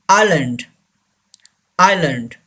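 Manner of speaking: "island" said as eye-lund; silent S. Shown as a demonstration. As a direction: drop the S in "island". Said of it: "S is silent here". ("island" में S silent, "आइलंड")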